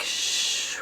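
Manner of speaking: whispered shh; stunned hushing sound; shushing. Retold or imitated. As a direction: hiss a shocked shush. Reaction to plot twist.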